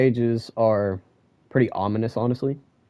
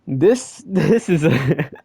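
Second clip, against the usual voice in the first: high laugh breaking through the words; bursting laugh; 9 semitones higher, much slower than usual. Real, not acted. Reacting to Shiba's reunion joke, laughing. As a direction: crack up mid-sentence.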